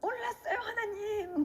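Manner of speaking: re-enacted wail, pitched high; childlike tearful begging. Begging God like a child to parents, high tearful plea. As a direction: cry it out like a pleading child. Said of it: "애기가 엄마 아빠한테 막 이렇게 비는 것처럼".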